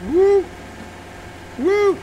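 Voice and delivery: very high, loud whoops; excited whoop. Real, not acted. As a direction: whoop with glee. Cheering during game stunt.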